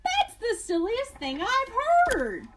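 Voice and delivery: high, loud, laughing through the words; roaring with laughter. Acted. Rhinoceros laughing at the little porcupine, high and loud. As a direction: crack up at the silly name. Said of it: "He roared with laughter".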